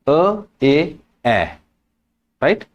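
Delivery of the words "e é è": "A, A, A" as "uh, ay, eh"; three E sounds contrasted. Shown as a demonstration. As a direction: step from schwa through é to open è. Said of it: "it makes the sound".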